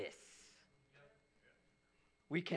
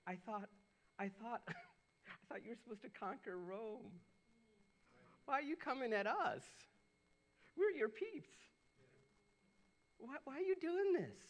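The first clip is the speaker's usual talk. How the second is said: rising higher and higher into a whiny protest; mock indignant crowd; 6 semitones higher than usual. Pastor voicing the crowd protesting to Jesus, mock whiny. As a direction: play the betrayed followers, voice climbing. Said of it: "And they're like".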